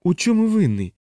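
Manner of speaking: high pleading question. Acted. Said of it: "благально запитав капелан".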